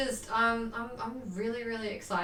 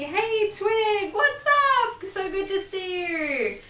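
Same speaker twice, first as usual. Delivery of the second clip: excited; 10 semitones higher, 4 dB louder, much slower than usual. Real, not acted. Greeting a viewer excitedly.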